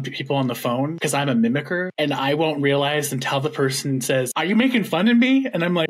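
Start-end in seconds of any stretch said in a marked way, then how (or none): 4.4-5.5 higher, louder indignant question; mock-offended challenge with a rising pitch; offended caller. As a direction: act out someone who feels mocked. Caller feels mocked, retold.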